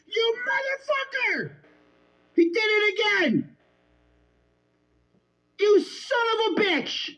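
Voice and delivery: outraged streamer swearing at the screen at full volume; furious yelling. Real, not acted. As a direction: scream your fury at him, it's real. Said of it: "I'm sorry for yelling".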